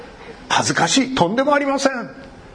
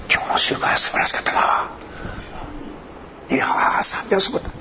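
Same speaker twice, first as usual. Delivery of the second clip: whispering the praise so nobody hears; young Christians whispering about the meeting on the train, hushed; 2 semitones higher than usual. Retold or imitated. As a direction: keep it under your breath, timid and secret. Young believers chatting on train.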